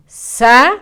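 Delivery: "Sa de zapato" said slowly, "Sa" leaned on; slow example. Shown as a demonstration. Teacher sounds out the example word slowly for the child. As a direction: linger on "Sa" in "Sa de zapato". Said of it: "Este es un zapato".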